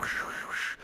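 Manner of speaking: soft voiceless hissing bursts; shushing imitation of a crackling radio; imitated radio static. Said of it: "it started going".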